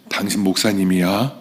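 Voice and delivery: ordinary delivery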